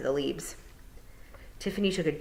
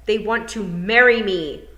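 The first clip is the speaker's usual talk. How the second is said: slow, louder, leaning hard on marry; stressed marry; 5 semitones higher, 6 dB louder, slower than usual. Acted. Tiffany telling the toad, slow and insistent. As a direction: press the word marry.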